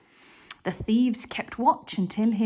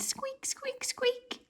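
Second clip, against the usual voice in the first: tiny squeak, much higher and softer; mouse voice; 9 dB quieter, slower than usual. Acted.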